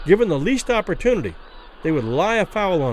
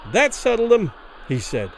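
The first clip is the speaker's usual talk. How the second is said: firm, higher-pitched pronouncement; a commanding trainer's verdict; authoritative; 5 semitones higher than usual. A narrator's reading. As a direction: state it with authority. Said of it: "He spoke with authority".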